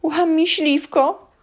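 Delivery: high, loud stammering elf; frightened elf fumbles the giant's name; panicked squeal. A narrator's reading.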